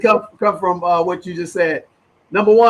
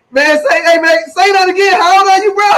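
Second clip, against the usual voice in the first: shouting in delight, much higher and louder; excited shout; 12 semitones higher, 6 dB louder than usual. Real, not acted. Host cheers the guest after hearing he's twenty-one, shouting excitedly. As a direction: belt it out like you can't believe it.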